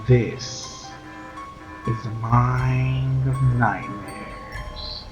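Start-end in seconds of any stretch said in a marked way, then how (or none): none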